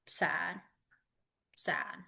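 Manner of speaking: soft, fading mild sadness; understated sad sign voice. Shown as a demonstration. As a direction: let your voice droop a little. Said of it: "drop your shoulders".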